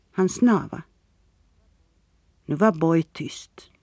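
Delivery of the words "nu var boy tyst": plain reading voice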